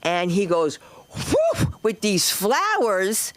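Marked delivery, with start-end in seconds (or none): 1.1-1.7 high, airy swoosh; whoosh noise. Retold or imitated. Comic imitating boyfriend swinging the flowers, whooshing. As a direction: imitate a fast swipe with a puff of sound.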